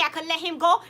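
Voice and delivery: usual speaking voice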